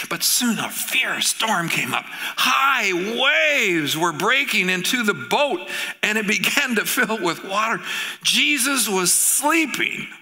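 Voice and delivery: slow, rising storm narration; dramatic storytelling. A narrator's reading. Preacher dramatizing the storm for his congregation, slow and high. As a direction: build the storm slowly.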